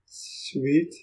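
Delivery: ordinary talk, nothing marked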